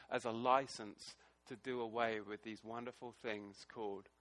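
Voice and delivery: usual speaking voice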